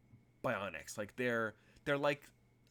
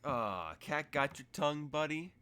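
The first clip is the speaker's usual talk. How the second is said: teasing lilt rising higher; mocking; 5 semitones higher than usual. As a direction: needle him like a playground bully. NPC mocks the silent player.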